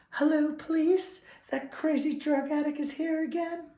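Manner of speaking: very high-pitched mock lady voice; high falsetto caricature of a store lady; store lady impression. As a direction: put on a squeaky-high worried shopkeeper calling police. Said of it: "and the lady's like".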